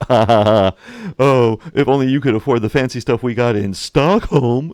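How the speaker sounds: loud, put-on snobby voice dripping with disdain; snooty rival. Rival mocking France's poverty, in a loud put-on snooty voice. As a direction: sneer down at the poor cousin, loudly.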